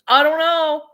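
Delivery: mock outrage; loud exasperated. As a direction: throw it out in frustration. Exasperated at prices.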